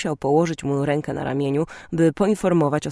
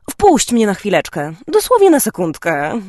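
high, loud, sugary little voice; wheedle, dripping with sweetness; sickly-sweet pleading; 5 semitones higher, 7 dB louder than usual. A narrator's reading. Sister begging brother for the computer, sickly sweet. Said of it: "poprosiła głosikiem kapiącym od słodyczy".

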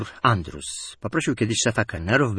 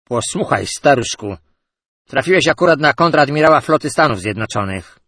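loud, higher, stately rebuke; dignified; 5 semitones higher, 8 dB louder than usual. A narrator's reading. Rear admiral rebuking the drunk, with loud dignity. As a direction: draw yourself up with dignity. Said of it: "z godnością rzekł".

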